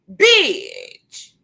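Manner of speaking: BITCH pitched high, swooping down; high, drawn-out, falling BITCH; exasperated outburst. Real, not acted. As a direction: stretch BITCH out from a high start with a long fall. Repeats insult higher, drawn out.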